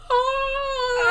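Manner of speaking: high falling scream. Acted. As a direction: scream as you plunge off the bridge. Professor falling.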